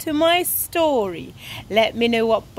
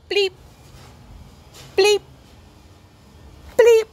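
short high imitated bleeps; spaced-out electronic beeps by mouth; spaceship bleeps; 7 semitones higher, 5 dB louder than usual. Ted floating in space as astronaut, mimicked bleeps.

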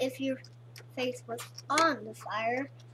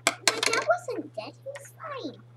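quoting himself; 7 semitones higher than usual. Retold or imitated. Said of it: "but I said".

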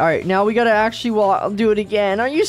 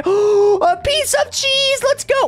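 gasping surprise; 12 semitones higher, much slower than usual. Real, not acted. Finds cheese in game.

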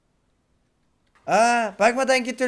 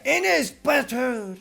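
ordinary delivery

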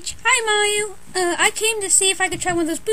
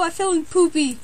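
ordinary talk, nothing marked

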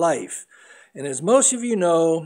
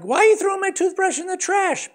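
put-on much higher, fast, outraged little kid; high-pitched kid protest, louder; indignant boy; 14 semitones higher, 4 dB louder, faster than usual. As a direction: squeal it like a wronged child. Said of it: "And it's like".

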